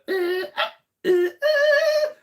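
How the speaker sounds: loud high-pitched electronic squeal; imitated loading screech. Gamer recalling old games loading from tape, screeched noise imitation. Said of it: "then wait for that one to".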